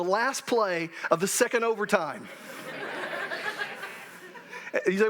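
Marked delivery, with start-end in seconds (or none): 2.3-4.0 high, soft rueful laugh. Real, not acted. Pastor laughs softly and high about the last play. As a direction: laugh at the painful memory. Recalling the lost game.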